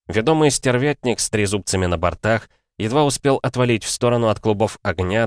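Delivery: ordinary delivery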